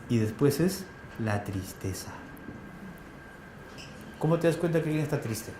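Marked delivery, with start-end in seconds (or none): 0.1-2.1 saddening voice. Shown as a demonstration. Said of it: "naming sad voice".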